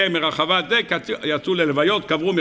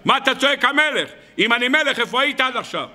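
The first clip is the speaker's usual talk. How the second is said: retold divine rebuke; 6 semitones higher than usual. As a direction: act out God's indignant challenge in a raised pitch. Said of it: "הקדוש ברוך הוא צועק עליי".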